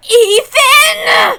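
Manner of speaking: high, loud stunned cry; shocked shout. Acted. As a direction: blurt it out in disbelief. Detective stunned by witch's rule.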